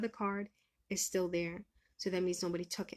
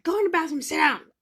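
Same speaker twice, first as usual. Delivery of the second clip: loud, higher urgent inner voice; 8 semitones higher, 11 dB louder than usual. Retold or imitated. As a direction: bark the order like a voice inside you. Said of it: "my spirit was like".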